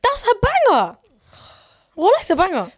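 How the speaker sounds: high, loud excited praise. Real, not acted. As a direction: hype the song up.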